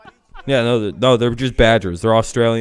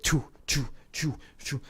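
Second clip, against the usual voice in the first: short airy "choo" puffs, softly spat out; imitated shooting noises; 3 semitones higher, 11 dB quieter than usual. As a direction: imitate quick little shots with the mouth, softly. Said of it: "Motherfucker just be like".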